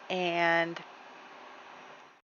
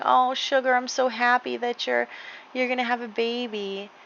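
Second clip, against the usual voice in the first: tender to horse; 5 semitones higher, 5 dB quieter than usual. Real, not acted. Talks fondly to her horse.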